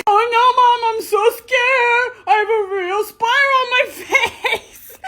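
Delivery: high, giggling mock panic over a funny filter; giggling mock scared. Real, not acted. Kid giggling to mom at a face filter, high mock fright. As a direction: squeal with laughter while pretending to be scared.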